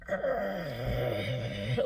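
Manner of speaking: long low imitated growl; soft, deep growl, drawn out. Storyteller as possessed friend turning over, growling. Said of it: "like, growling".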